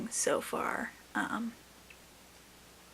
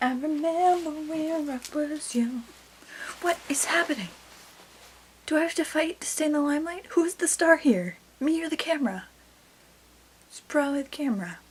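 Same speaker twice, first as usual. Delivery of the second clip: mock diva; 6 semitones higher, faster than usual. Mock diva fighting the camera.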